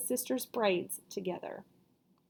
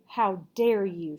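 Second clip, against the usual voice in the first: loud, slow indignation; demanding; 6 dB louder, slower than usual. A narrator's reading. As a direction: demand it, outraged. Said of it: "she demanded".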